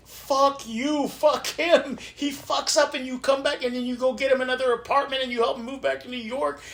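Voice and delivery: a hint of sisterly outrage; mock angry sisters. Sisters ranting at their father about the brother, mock-exasperated. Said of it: "my sisters would be like".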